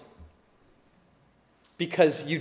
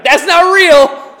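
much higher and louder, slow, laughing through it; mock dad protest; 11 semitones higher, 12 dB louder, much slower than usual. Dad mocking his kids' fake joy, loud laughing protest. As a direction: laugh while loudly refusing the fake joy. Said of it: "And I'm like".

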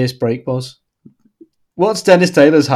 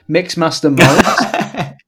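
slow, put-on name reveal; silly announcement; 3 semitones higher, slower than usual. Reading a funny name to co-host, slow silly voice. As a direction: draw the name out for a laugh.

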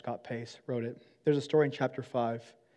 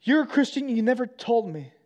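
much higher and louder, wounded; hurt accusation; 9 semitones higher, 6 dB louder than usual. Retold or imitated. Imagined friend accusing the pastor, high and hurt. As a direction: cry it out as a betrayed friend. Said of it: "I don't want anyone to come up".

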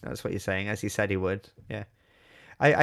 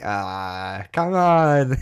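exasperated; 3 semitones higher, 6 dB louder, much slower than usual. Acted. Mock exasperation at dull reading.

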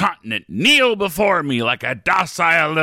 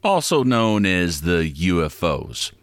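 low, deadpan explainer voice; low conspiratorial host; 9 semitones lower than usual. Acted. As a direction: lay it out calmly and low. Different low-voiced segment.

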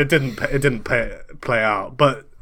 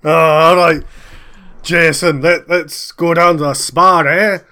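loud, higher Scottish character voice; jolly the star along in a big Scottish voice; eager Scottish agent; 4 semitones higher, 7 dB louder, slower than usual. Acted. Agent taking his client to the spa, loud put-on Scottish voice.

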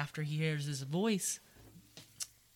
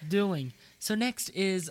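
loud announcing; 5 semitones higher, 9 dB louder than usual. Real, not acted.

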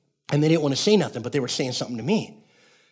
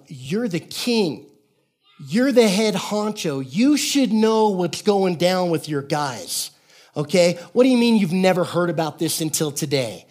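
slow, emphatic, raised-pitch confrontation; indignant challenge; 3 semitones higher, slower than usual. Acted. Imagined Abraham confronting the king, mock-indignant. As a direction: hit each point deliberately, as if calling him out.

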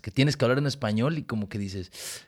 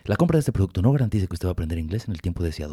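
fast disclaimer; 4 semitones lower, faster than usual. Acted.

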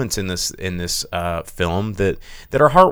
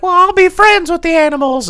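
put-on much higher, louder girlish voice; sweet naive girl, pitched way up; little girl voice; 8 dB louder than usual. Girl voiced in movie recap.

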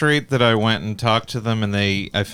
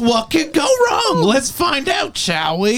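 mock announcer; 13 semitones higher, slower than usual. Mock announcer voice, bit shout.

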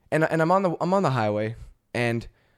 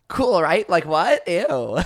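louder, higher mocking girl squeal; 5 semitones higher, 3 dB louder, much slower than usual. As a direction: parody the trend, laughing.

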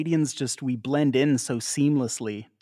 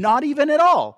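much higher and louder, all in; emphatic denial; 10 dB louder than usual. Real, not acted. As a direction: squeal the denial with full conviction. Insisting the show isn't racist.

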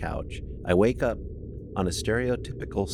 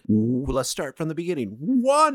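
Count voice; 8 semitones higher, 4 dB louder than usual. Pretend. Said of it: "You'd be like".

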